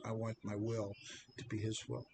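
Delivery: ordinary delivery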